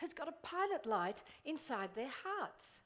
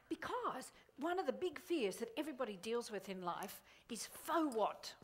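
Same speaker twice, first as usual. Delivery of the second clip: ordinary talk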